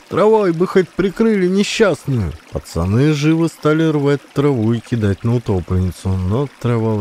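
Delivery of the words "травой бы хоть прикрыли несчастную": high, indignant woman's rebuke; scolding. A narrator's reading. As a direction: chide them, half pity half anger. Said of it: "Напустилась она на пацанов". (возмущённо отчитывает)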